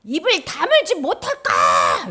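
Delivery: high, loud, drawn-out command to shut up; furious acted rebuke; scolding shout. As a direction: silence the accuser with a roar. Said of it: "사탄한테".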